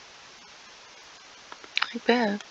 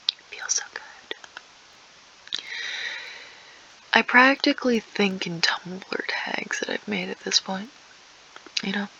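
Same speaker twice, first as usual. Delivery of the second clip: whisper. Acted. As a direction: whisper it like a secret aside. Long whispered aside.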